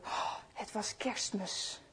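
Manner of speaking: slow, hushed whisper. A narrator's reading. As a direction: breathe it out in wonder. Narrator hushes the Christmas moment. (langzaam, fluisterend vol verwondering)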